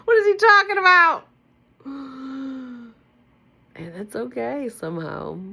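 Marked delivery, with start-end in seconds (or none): 0.0-1.3 high, loud squeal of laughing disbelief; giddy laughing. Real, not acted. She reads the book aloud and cracks up, giddy. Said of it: "find me being almost giddy as I read". 1.8-2.9 gasp. Real, not acted. After giddy laughing fit.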